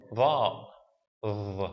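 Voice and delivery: "Va", then "v" alone, as a heavy emphatic voiced th. Shown as a demonstration. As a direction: demonstrate "Va" as the emphatic Arabic letter. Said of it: "it's like an emphatic d".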